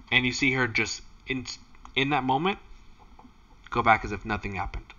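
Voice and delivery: ordinary talk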